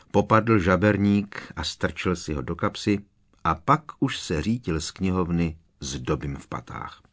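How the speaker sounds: ordinary delivery, nothing marked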